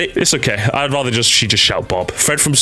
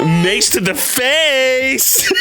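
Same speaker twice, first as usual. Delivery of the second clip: higher, very slow mock voice-line delivery; imitating Brigitte; 10 semitones higher, much slower than usual. Shown as a demonstration. Streamer copying how Brigitte says face, slow and higher. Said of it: "That's the way she says face".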